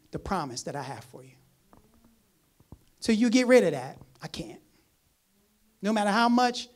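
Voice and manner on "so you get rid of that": usual speaking voice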